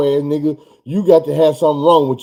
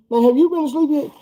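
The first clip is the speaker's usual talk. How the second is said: high, disbelieving question; mock exasperated; incredulous; 10 semitones higher than usual. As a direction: ask it like you can't believe it.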